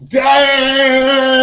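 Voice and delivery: long, high, loud exclamation; real outburst of disbelief; shocked shout. Real, not acted. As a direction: yell it out, stretched high. Loud reaction to Canada insult.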